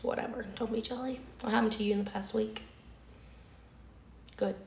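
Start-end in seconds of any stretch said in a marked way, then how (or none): none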